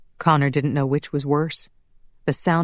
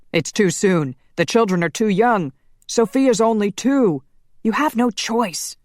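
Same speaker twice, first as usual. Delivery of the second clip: gritted out; 6 semitones higher, 4 dB louder than usual. A narrator's reading. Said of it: "Laszlo gritted out".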